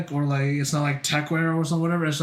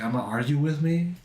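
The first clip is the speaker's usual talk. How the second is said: ordinary delivery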